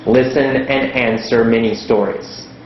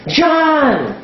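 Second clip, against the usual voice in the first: high excited shouted yes; eager shout; 13 semitones higher than usual. Retold or imitated. Student shouting the answer to the teacher, high and excited. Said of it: "so you guess".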